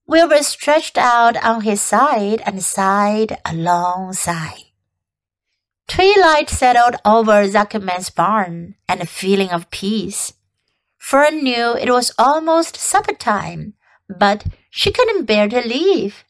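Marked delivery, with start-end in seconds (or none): none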